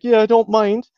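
put-on high-pitched Wexford girl; mock Wexford accent. Girl accepting a date, mock Wexford accent. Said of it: "they had that horrible accent".

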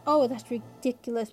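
ordinary delivery, nothing marked

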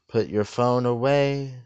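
usual speaking voice

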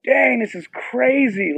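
high and drawn-out disbelief; can't believe what's happening; amazed. Retold or imitated. Reacting in the stands.

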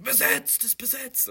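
high loud shout from inside; shouted occupied. Acted. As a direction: yell out from the toilet, high-pitched. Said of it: "also wenn du hörst".

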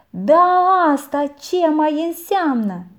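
high, loud astonished question; aunt finds the boy scrubbing a barrel; amazed aunt. A narrator's reading. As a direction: sound baffled at the sight. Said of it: "se miră femeia".

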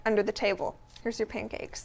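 secretive hushed voice. Retold or imitated. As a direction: say it hush hush, under your breath. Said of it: "It was hush hush".

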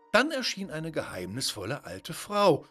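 usual speaking voice

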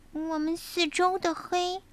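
high, small scared cub voice; little bear cub voice. Acted. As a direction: sound like a frightened little cub. (小熊胆怯的童声)

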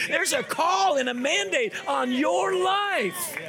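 high, impassioned preacher's cry, stretching the key words; full-force preaching. Real, not acted. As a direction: build to a ringing, drawn-out declaration. Preacher exhorts the congregation.

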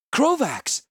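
high, loud anguished cry of his name. A narrator's reading. Healer cries out to thrashing Krovax, loud and high. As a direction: call out to stop a friend in pain. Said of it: "I cried".

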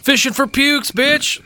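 mock yell. Mock insult in character.

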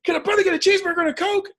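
loud, high, fast indignant demand; acted angry outburst. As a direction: blow up at being ignored at the counter. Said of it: "I just get really angry and I slam my fist".

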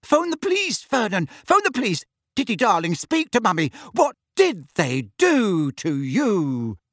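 high, panicked shriek; shrieking mother. Acted. Mother screaming at husband to call police, shrill panic. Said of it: "Aunt Petunia screamed".

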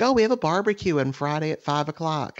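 high, sing-song mock neighbor; chirpy neighbors. Mocking neighbors' barbecue invite, sing-song. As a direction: imitate cheerful neighbors inviting him. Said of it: "They're like".

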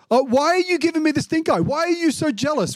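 high, outraged "why are you giving me the stink eye"; indignant landowner. Acted. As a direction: play the offended boss, voice up high. Landowner confronting grumbling workers.

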